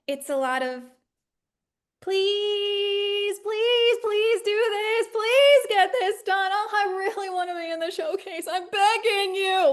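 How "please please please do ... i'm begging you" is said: higher, slow, pleading; mock begging. Student producer begging crew, higher and pleading. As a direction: beg like a desperate student producer. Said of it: "a lot of begging and pleading".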